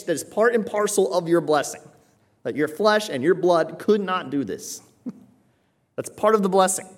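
impassioned preaching. Acted. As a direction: preach it with rising fire over Peter's blessing.

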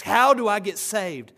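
loud, quick, urgent asking; eager question. Retold or imitated. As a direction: burst out with the question. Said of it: "you might be asked".

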